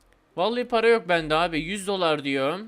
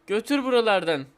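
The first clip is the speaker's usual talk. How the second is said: ordinary talk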